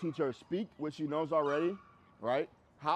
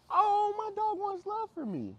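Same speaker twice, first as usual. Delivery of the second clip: high, gushing mock owner voice, much higher and louder; naive dog owner; 4 dB louder, slower than usual. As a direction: squeal it like a doting owner. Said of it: "some may read that as".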